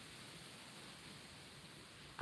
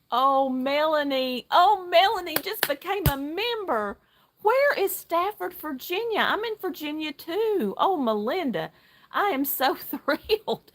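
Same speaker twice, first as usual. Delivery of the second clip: much higher, bubbling over with delight, laughing; thrilled squeal; 8 semitones higher, 3 dB louder than usual. Real, not acted. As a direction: gush over a new channel member. New member joins the livestream.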